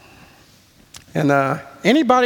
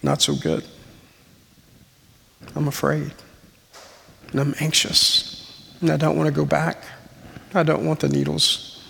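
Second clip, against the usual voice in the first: softer, hushed and anxious; quiet frightened confession; 4 dB quieter than usual. Retold or imitated. Husband confesses fear to his wife on the drive, hushed and afraid. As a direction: admit the fear barely above a breath. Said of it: "she says".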